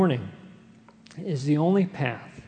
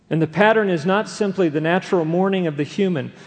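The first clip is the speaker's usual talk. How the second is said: normal talking voice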